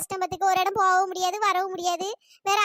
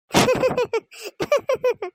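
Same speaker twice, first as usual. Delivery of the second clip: laugh; 3 dB louder than usual. Acted. Laughs after joking about goat.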